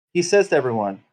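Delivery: normal talking voice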